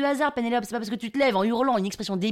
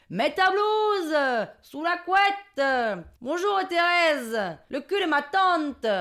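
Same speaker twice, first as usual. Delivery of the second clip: high, slow chanted bingo calls; mock crowd hollering; 5 semitones higher, much slower than usual. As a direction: stretch each phrase like a hall heckler. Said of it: "tout le monde hurle des phrases". (phrases hurlées et traînantes)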